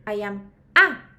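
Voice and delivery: slow, with a very loud A; stress on A. Shown as a demonstration. Teacher modelling a speaking answer, stress on A. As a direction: stretch the phrase and hit A hard. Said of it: "si les preguntan".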